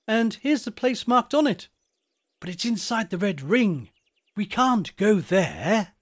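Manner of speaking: high-pitched, resourceful schoolboy; eager schoolboy character. Acted. Wilfrid's lines in the story.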